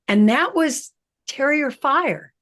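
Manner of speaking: normal talking voice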